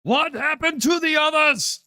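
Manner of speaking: very high, panicked cry; alarmed bystander. Acted. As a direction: shriek the question from the boat. GM plays alarmed boat person.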